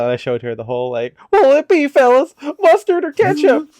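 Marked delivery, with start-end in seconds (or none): 1.3-3.7 very high, loud put-on character voice; exaggerated high-pitched imitation; movie character impression. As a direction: call it out way up high, like a cartoon. Imitates SpongeBob movie line from video.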